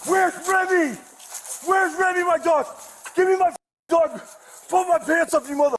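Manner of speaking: high, frantic yell for the dog; desperate shouting. Real, not acted. Suspect screams at officers for his dog, frantic. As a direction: demand the dog back at full voice.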